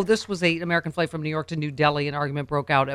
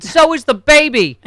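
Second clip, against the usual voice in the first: yelling; 10 semitones higher, 12 dB louder, slower than usual. Retold or imitated. Man yelling back at attendant.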